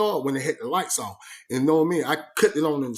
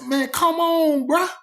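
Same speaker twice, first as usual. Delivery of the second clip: a slow, much higher come on, bruh; exasperated disbelief; 11 semitones higher, 3 dB louder, slower than usual. Retold or imitated. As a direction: stretch it out in outrage. Outraged at water being cut off.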